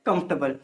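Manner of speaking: कंफर्टेबल said KUMF-tuh-bl; correct pronunciation. Shown as a demonstration. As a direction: say कंफर्टेबल short in the middle. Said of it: "इसका right pronunciation होता है". (कंफर्टेबल को "कम्फ़्टबल" बोलें)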